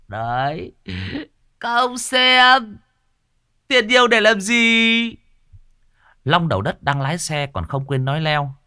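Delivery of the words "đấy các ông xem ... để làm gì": high, stretched out and louder; smug gloating. Acted. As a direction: drawl it like a gloating boss. Boss gloats over money. (giọng đắc ý, kéo dài, cao và to)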